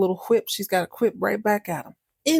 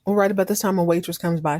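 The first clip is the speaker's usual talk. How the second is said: ordinary talk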